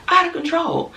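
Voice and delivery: exaggerated high exclamation; high exclaim. Shown as a demonstration.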